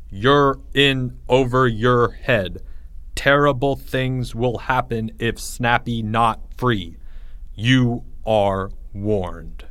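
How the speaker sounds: slow, measured warning; ominous note reading. A narrator's reading. Onlooker reads a warning on the door, ominous. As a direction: spell out the threat word by word, very slowly. Threatening note read aloud.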